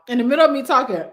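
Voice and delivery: giggling through the words; laughing. Real, not acted. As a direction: laugh it off, a bit embarrassed. Laughing after choking fit.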